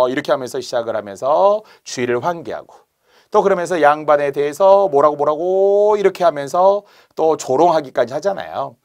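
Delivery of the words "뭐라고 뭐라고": mock jeering. Acting mocking chatter about the nobleman.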